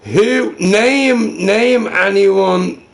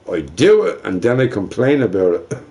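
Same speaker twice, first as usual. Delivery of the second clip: usual speaking voice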